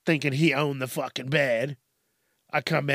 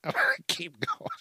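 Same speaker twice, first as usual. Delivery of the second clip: laughing; 4 dB quieter than usual. Real, not acted. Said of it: "laughing through words".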